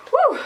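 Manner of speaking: big sigh with a high whew; relieved exhale. Real, not acted. Storyteller pausing after a hard memory, sighing whew. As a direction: blow out the tension of the story. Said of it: "This was a hard one".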